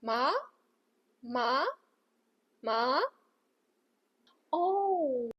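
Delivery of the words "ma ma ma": Ma? rising smoothly like a hopeful question; second tone rising. Shown as a demonstration. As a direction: lift ma upward as if asking. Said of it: "the second tone rises".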